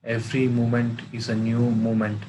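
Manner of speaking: measured meditation guide with long pauses; guided meditation. Acted. Teacher guiding friends to watch their breath, guided meditation. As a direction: lead them into stillness, phrase by phrase, in an even tone.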